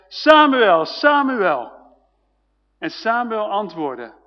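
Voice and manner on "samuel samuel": loud, high call of a name. A narrator's reading. The Lord calls the sleeping boy by name, loud calling. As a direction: call the name out twice, falling each time. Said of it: "riep net als de voorgaande keren".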